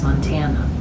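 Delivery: usual speaking voice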